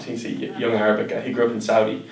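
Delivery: ordinary delivery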